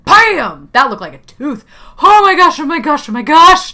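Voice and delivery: high, loud thrilled exclaiming; gleeful squeal; excited shriek. Real, not acted. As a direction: gamer delighted by the hit. Gamer reacting to hit.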